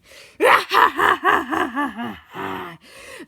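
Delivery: loud, high evil cackle; evil villain laugh. A narrator's reading. X gloats over Steve, cackling. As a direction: cackle in long rhythmic bursts.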